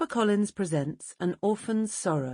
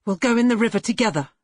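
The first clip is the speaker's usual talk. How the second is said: fast, louder and higher, frantic; wild despairing mother; 5 semitones higher, 5 dB louder, much faster than usual. A narrator's reading. Mother tells Cassie they'll drown together. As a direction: rush it out, half mad with despair.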